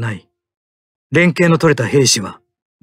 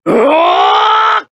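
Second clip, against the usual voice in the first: long drawn-out high roaring yell; battle roar; 13 semitones higher, 4 dB louder, much slower than usual. Acted. Pilot unleashing the final attack, long drawn-out roaring yell. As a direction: roar it out slowly at full force. Full-force charging battle cry.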